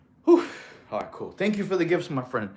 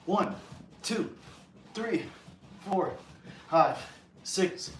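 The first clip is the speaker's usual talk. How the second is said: softer, winded counting; out of breath; 3 semitones higher, 7 dB quieter, slower than usual. Real, not acted. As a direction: count through tired breaths. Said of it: "I'll count out loud".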